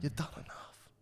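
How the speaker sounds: soft unvoiced whisper, pleading; whispered plea. Real, not acted. Whispering to Miesha that she has done enough. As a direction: breathe it out gently.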